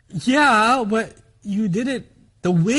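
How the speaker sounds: ordinary delivery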